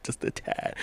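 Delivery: a hushed afterthought to herself; soft whispered repeat; whispered aside. Real, not acted. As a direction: whisper it under your breath. Repeats the advice under her breath.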